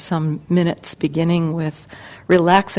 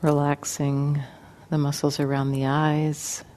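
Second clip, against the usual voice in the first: guided meditation; 3 semitones lower, 8 dB quieter than usual. Acted. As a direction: ease them gently into relaxation, unhurried and quiet.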